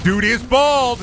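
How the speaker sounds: loud high-pitched exclamation; high, loud outburst; amazed shout. Real, not acted. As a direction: shout it out in amused disbelief. Exclaiming at game character.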